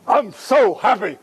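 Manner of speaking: high, drawn-out show of happiness; mock delight. Praised pupil answers coach with high, drawn-out mock delight.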